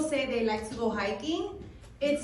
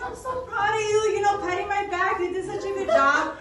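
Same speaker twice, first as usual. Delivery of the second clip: mock proud boyfriend; 6 semitones higher than usual. Boyfriend praising her.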